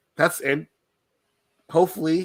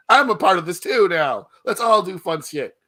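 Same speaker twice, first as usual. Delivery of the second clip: excited; 7 semitones higher, 8 dB louder than usual. Retold or imitated. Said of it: "was like".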